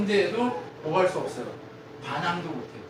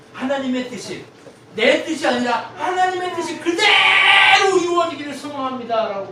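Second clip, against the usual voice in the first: fervent declaration; 10 semitones higher, 11 dB louder than usual. Acted. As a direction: cry it out to God, swelling louder. Fervent declaration of surrender to God.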